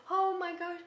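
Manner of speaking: crying mom reunited with her daughter at the hotel; mom's tearful relief. Retold or imitated. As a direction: voice the mom bursting out in relieved tears. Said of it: "and she's crying".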